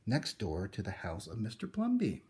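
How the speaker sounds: plain reading voice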